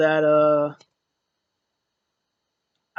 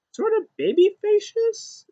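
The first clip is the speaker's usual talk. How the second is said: mock baby voice; slower than usual. Mocking baby face term.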